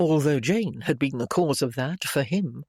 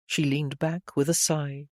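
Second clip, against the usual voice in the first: ordinary delivery, nothing marked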